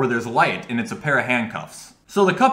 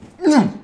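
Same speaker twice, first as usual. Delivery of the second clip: loud, high, pointed throat clearing; mock husband clearing his throat; 13 semitones higher, 5 dB louder than usual. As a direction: clear your throat to signal disapproval. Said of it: "the husband immediately just goes like this".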